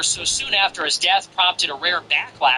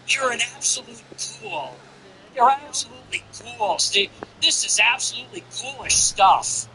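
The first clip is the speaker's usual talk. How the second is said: mock an appalled online poster; put-on high voice of a scandalized scold; mocking Twitter voice; 7 semitones higher than usual. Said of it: "They all talk like this".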